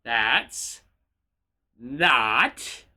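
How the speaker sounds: "That's" set low, then a separate "not"; stress on NOT. Shown as a demonstration. Streamer correcting how chat says it, weight on "not". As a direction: pause before "not" to single it out. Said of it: "emphasis on not".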